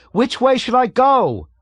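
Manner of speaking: very high, loud suffering shout; furious high-pitched shout. A narrator's reading. Pilot Makwat shouts furiously over the intercom. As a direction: yell furiously, voice strained high. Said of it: "shouted furiously ... high-pitched voice".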